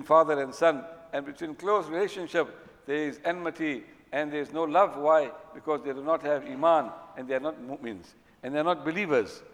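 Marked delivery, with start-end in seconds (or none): none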